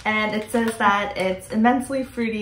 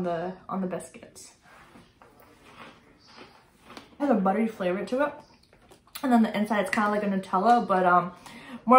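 chewing between words; talking while chewing. Real, not acted. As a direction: mouth partly full. Seen 2: Left speaker bit biscuit at -3, chewing cheeks and hand-to-mouth early on.

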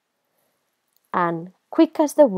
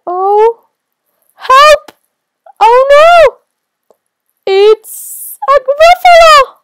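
very high, full-volume panicked scream; terrified cry; 16 dB louder, slower than usual. Acted. Mouse cries for help at the monster, screaming. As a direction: scream it out in terror, drawing out the end.

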